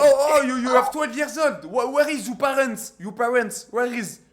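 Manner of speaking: loud, high-pitched scolding; indignant shouting. Real, not acted. Streamer scolding kids on video chat, indignant shouting. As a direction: shout it in real outrage, pitched well up.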